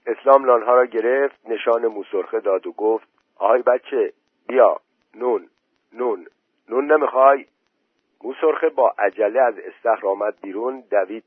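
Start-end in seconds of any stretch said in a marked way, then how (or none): 3.4-7.5 lightly raised, higher luring call; coaxing call. A narrator's reading. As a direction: tempt the child over, bit by bit. Luring boy out with bread.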